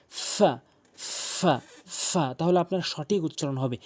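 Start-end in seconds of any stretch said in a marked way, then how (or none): 0.1-2.3 ফা with upper teeth on inner lower lip; lip-and-teeth sound. Shown as a demonstration. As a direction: repeat ফা separately, teeth resting on the lower lip. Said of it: "নিচের ঠোঁটের পেট এভাবে রেখে উচ্চারণ". (ফা নিচের ঠোঁটের পেটে দাঁত রেখে)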